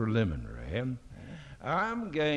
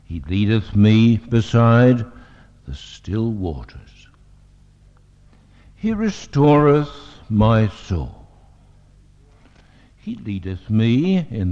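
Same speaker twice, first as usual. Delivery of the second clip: louder, solemn reading of the psalm; scripture reading; 3 semitones lower, 8 dB louder than usual. Acted. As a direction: intone the psalm to the congregation. Reads Psalm 23 aloud.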